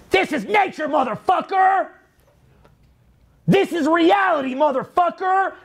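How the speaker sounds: yelling at the top of the voice; mock shout. Speaker urging listeners to go outdoors, hollering. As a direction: bellow it out like a wild man in the woods. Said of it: "go out into nature and be like".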